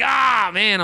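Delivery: high, loud, drawn-out exclamation; mock pained cry. Retelling getting burned, high loud mock cry.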